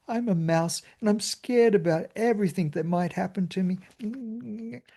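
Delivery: high squeaky mock fear, like a frightened mouse; hammed-up mousy fearful voice; mock timid mouse. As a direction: squeak it out as the scared little mouse. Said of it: "rather than".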